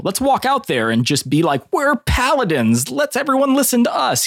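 high, slowing mock-heroic proclamation; mock proud paladins. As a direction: proclaim it like self-important paladins, pitch climbing. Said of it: "their mentality of, like, you know".

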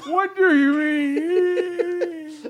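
high loud squawking frog. Acted. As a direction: shriek it as a startled frog. Frog character reacts to vision.

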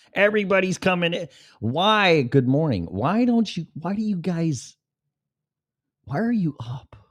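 high, bright teasing welcome; playful greeting. Real, not acted. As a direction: greet the viewers as they join, playfully high. Greeting viewers joining the live.

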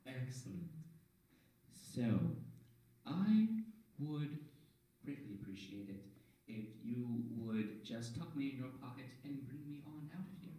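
soft, halting otherworldly voice with pauses; mysterious orb voice. Acted. Orb asking to be carried out, hushed eerie voice. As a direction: ask it quietly, drawing out the pauses.

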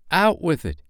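put-on high, sharp boyish demand; impatient shout. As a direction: shout it impatiently, pitch jumping up. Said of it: "he shouted".

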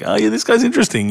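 high, amused impressed voice; impressed quote. Retold or imitated. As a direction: mimic someone pleasantly surprised.

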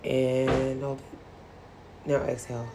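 soft, very slow breathing cues; quiet slow meditation guide; guided breathing. Shown as a demonstration. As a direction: ease them through the breath softly. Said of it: "I'm gonna do one more".

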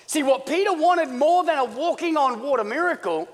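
loud, high impassioned preaching; full-force preaching. Real, not acted. Preacher explaining what Peter wanted, full-force preaching. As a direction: drive the point home at full voice.

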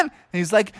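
usual speaking voice

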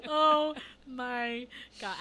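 high squealing laugh. Real, not acted. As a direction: break up laughing at the pun. Cracking up at a pun.

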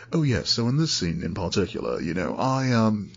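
a hammed-up Brit at an interview, trailing off breathless; bad British accent. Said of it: "That was awful".

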